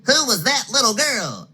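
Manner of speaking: small high excited cub; Baby Bear. Acted. Baby Bear alerting his parents, high and excited. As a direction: point and squeak like a little bear. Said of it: "asked Baby Bear".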